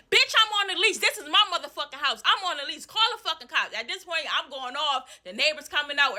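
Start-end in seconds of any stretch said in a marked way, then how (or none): 0.1-3.8 high, scornful yell; furious yelling. Retold or imitated. Tenant dares landlord to call the cops, high yell. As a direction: dare her to call.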